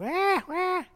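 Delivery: high imitated infant "ah, ah"; baby babble. Preacher mimicking a hungry infant, high baby sounds. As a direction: mimic a baby fussing for food.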